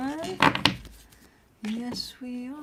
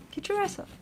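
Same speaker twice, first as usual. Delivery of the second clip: playful high coax; 12 semitones higher, faster than usual. Real, not acted. Coaxing dog playfully.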